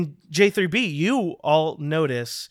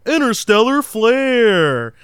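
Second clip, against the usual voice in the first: game show announcer; 6 semitones higher, 8 dB louder than usual. Acted. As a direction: announce the host like a big TV show. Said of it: "that ethereal voice returns".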